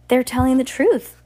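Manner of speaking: high, loud outcry; crying out. A narrator's reading. Frank defends the children, loud high cry. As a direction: cry it out to everyone. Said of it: "Frank cried".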